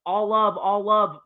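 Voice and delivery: lightly mocking repeat; mocking quote. Podcaster quoting the player's farewell, light mockery. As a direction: parrot his words back with scorn. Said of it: "and then you moved on and said".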